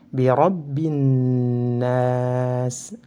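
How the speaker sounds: "rabbin nas" joined on a long humming n, no l; recited "Bi rabbin nas" with l assimilated into n; silent lam. Shown as a demonstration. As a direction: hold the n in "rabbin nas", then draw out nas. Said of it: "Lamnya tidak terbaca".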